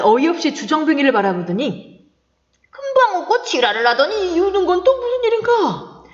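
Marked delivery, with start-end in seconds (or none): 2.7-6.0 baffled friend. Acted.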